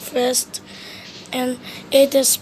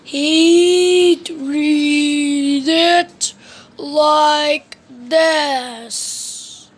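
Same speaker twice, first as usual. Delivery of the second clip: words dragged out, extremely slow; slow drawn-out Mister Slow voice; very slow reading; 4 dB louder, much slower than usual. Shown as a demonstration. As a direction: read it at a snail's pace. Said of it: "If he was reading this book".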